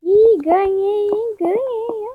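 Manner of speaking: excited win. Real, not acted. Wins the match.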